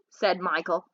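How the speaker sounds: usual speaking voice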